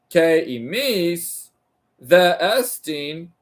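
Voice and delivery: careful, measured reading of ancient Greek; reading Greek aloud. Acted. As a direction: sound out each Greek word deliberately. Reads Greek text aloud slowly.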